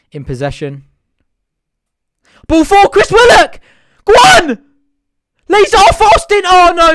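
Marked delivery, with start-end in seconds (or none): 2.5-6.4 screaming high and loud as the chance opens; full-throated fan roar at the attack; excited yell. Real, not acted. Fan commentary, big chance. 6.4-6.9 high, loud groan of a near miss; anguished cry. Real, not acted. Chance goes begging.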